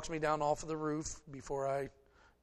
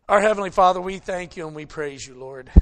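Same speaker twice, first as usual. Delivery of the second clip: loud, full-voiced prayer opening; 2 semitones higher, 13 dB louder than usual. Pastor leads the congregation in prayer, booming and reverent. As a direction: call out to the Father with the whole church.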